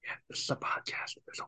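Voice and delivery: hushed aside in a whisper. Real, not acted. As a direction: whisper it so only the co-host hears. Whispered reminder, much softer.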